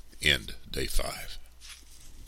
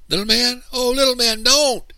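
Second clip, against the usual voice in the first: loud, very high panicked call; alarmed cry; 10 dB louder than usual. A narrator's reading. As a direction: cry out to stop your brother. Child calls to stop brother.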